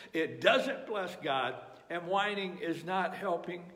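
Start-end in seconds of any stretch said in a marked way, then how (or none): none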